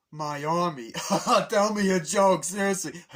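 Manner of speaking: loud, high mocking guffaw; imitated scornful laughter. Cranky Kong scoffing at plea for army, loud laughing mockery. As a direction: laugh the request off loudly.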